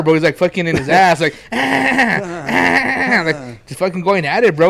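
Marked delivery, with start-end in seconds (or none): 1.2-3.7 drawn-out vocal engine-cranking noise; imitated car starting. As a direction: mimic a car struggling to start, higher than your talk. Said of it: "it's like he's starting a car".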